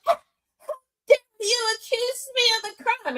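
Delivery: high, whining, put-upon; mock whiny outrage. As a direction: play the offended liar. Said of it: "She was like".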